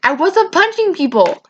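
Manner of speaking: high-pitched retold protest; indignant denial. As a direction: act out an outraged denial, voice jumping up. Acted denial of an accusation.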